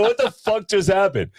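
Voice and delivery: high, drawn-out mock astonishment; stunned dad, retold in a stretched high pitch; incredulous. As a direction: replay the shock way up high. Repeats retold shock at new voice.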